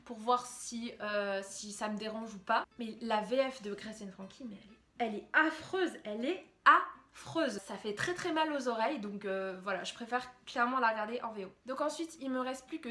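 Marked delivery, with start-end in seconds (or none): 5.0-7.6 higher, louder, leaning hard on "affreuse"; real, exasperated verdict on "affreuse"; emphatic disgust. Real, not acted. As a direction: hammer "affreuse", drawing it out on the repeat. Vents about awful French dub.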